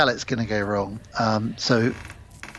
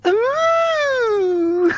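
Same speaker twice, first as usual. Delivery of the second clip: high mock ooh. Group reacting to Rory.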